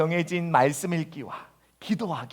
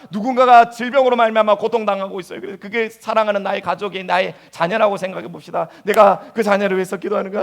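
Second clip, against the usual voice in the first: ordinary talk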